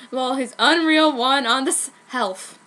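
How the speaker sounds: ordinary delivery, nothing marked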